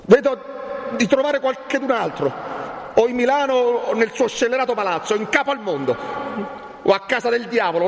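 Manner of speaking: high-pitched, impassioned outburst; vengeful rage. Acted. Renzo vows to find the scoundrel who parted them, furious. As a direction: start very high, then sink into a threat.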